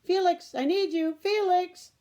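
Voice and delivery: loud high call across the yard; urgent calling out for Felix. Acted. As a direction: shout his name from a distance. Ida calling Felix from afar.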